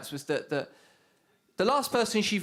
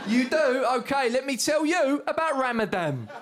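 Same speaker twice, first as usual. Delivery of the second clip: high and loud, laughing through it; hammed-up loud sales pitch with a laugh; mock preacher pitch; 6 semitones higher, 4 dB louder than usual. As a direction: keep the pushy street-preacher sell going, amused. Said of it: "you got to be shouting at me".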